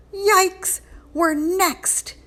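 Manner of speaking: high frightened yelp; gasping in fright. Acted. Ralphie fearing the children are next, high frightened gasp. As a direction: squeak it out, sure you're doomed. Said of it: "he gasped".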